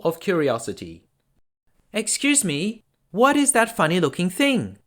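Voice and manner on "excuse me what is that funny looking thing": put-on high, light girlish voice; curious princess. A narrator's reading. The curious princess asks the old woman, high and light. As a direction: ask in innocent wonder. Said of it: "she asked the old woman".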